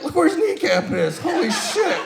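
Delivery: high, loud, laughing exclamation; cracking up in shock at the sight; shrieking with laughter at a dislocated knee; laughing disbelief. Retold or imitated. Laughing at friend's kneecap.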